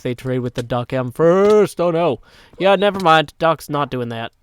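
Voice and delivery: loud, put-on accented villain boast; Doc M seventy-seven accent. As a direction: proclaim total control in a booming mock accent. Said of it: "That was my best Doc M seventy-seven accent".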